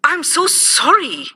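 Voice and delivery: high apologetic exclamation; exclaiming. Acted. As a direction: blurt out an apology. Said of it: "exclaimed Eric".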